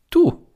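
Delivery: du pitched far up and loud; pointing straight at him with du; emphatic du. Acted. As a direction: hit the word du hard and high. Insisting it is Franz himself.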